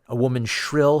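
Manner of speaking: plain reading voice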